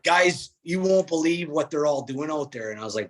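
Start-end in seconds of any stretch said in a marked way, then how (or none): 0.0-0.5 higher, louder eager call of guys; excited call. Retold or imitated. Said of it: "I'm not even gonna try to do a northern accent". 0.6-2.7 excited. Retold or imitated. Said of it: "I'm not even gonna try to do a northern accent".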